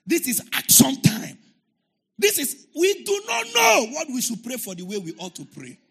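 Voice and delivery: loud, rising preacher's shout; shouted prayer declaration. Retold or imitated. Pastor answers his daughter, shouting his prayer declaration. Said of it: "you are shouting too much".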